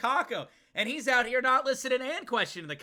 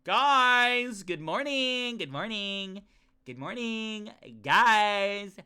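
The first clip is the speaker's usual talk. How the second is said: overeager game character; much slower than usual. Acted. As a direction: stretch the words out with bubbly eagerness. Voices an eager game character.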